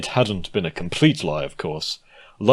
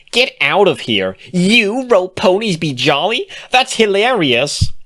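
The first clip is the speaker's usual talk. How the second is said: high, loud, delighted disbelief; gleeful squealing; 12 semitones higher, 8 dB louder than usual. Acted. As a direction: shriek with laughter in your voice. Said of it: "Bon Bon squealed".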